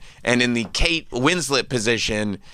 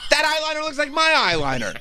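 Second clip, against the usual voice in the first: high, whiny put-on voice; mock jealous fiancé. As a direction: complain in a squeaky voice. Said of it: "fiancé continuing".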